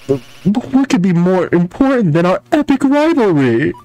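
whiny villain voice. Acted. Villain whining.

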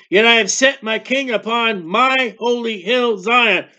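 preacher reading the Bible with weight; scripture reading. Acted. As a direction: proclaim the scripture to the congregation. Reads scripture aloud in sermon.

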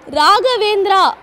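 drawn-out loud call of a name. Real, not acted. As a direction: call the name across the room. Host calls kid to stage.

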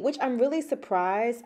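normal talking voice

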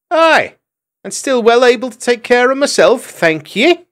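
very high, loud retort; a maid brushing off admirers; spirited hedgehog maid. A narrator's reading. Trimp retorts.

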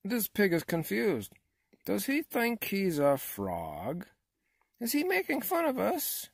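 high, baffled, increasingly affronted questioning; indignant frogs. A narrator's reading. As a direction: climb to a very high pitch of indignation.

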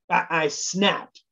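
ordinary delivery

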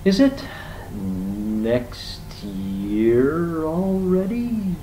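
high, extremely drawn-out question; very slow tortoise. A narrator's reading. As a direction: stretch each word out like a slow tortoise. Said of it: "said the animal incredibly slowly".

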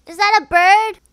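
loud, high startled question; startled alarm. Real, not acted. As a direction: blurt it out loudly in sudden alarm. Startled by bird in horror game.

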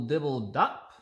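ordinary delivery, nothing marked

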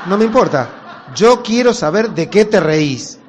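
loud slow demand; insistent shout. Acted. Wife demands the secret from her husband, loud and slow. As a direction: plant your feet and demand it.

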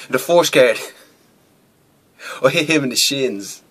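usual speaking voice

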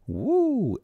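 a high, startled woo at the stench; a high yelp of disgust at the smell; high whoop. Acted. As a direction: whoop like the smell hits you. Reacting to the stench.

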